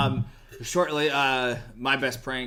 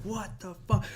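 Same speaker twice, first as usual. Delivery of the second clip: mock scolding; 8 semitones higher, 3 dB quieter than usual. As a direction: imitate a dad laying into you. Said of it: "just laid into me like".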